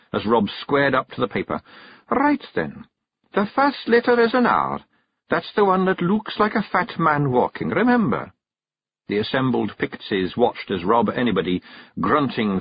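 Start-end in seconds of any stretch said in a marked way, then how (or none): 2.1-8.3 high Scots wife instructing; walk him through the letter; teaching Kelda. Acted. Jeanie's voice.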